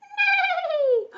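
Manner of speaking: high whinnying neigh; a long, high horse call. Pretend. Teacher imitates a horse for children, high neigh.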